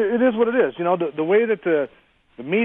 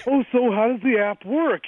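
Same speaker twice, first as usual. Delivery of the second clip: higher, slow, drawn-out mock of the chatty customer; mocking the chatty guy; 7 semitones higher, slower than usual. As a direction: mimic the slowpoke asking about the app, stretched and high. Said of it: "the guy's, like, talking and, you know, jiving with him".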